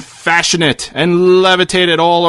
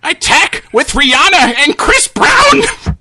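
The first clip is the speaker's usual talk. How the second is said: shouted attack; 9 semitones higher than usual. Acted. Old man calls attack.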